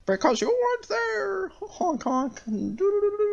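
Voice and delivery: very high, slow goofy clown voice; silly clown. Retold or imitated. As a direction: squeak it out like a dopey clown. Imitating Pennywise as silly clown.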